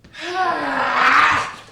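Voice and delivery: loud high anguished wail; anguished cry. Real, not acted. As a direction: cry out in despair. Anguished cry after outburst.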